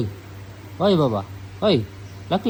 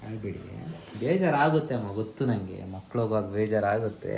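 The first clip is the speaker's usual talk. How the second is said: usual speaking voice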